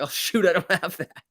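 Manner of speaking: high-pitched, laughing "oops"; mock dismay. Imagined reaction to owing money.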